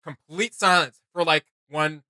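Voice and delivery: ordinary delivery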